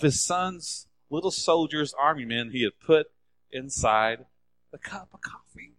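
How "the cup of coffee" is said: hushed whisper for suspense. A narrator's reading. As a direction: drop to a secret whisper. Suspense in the coffee story.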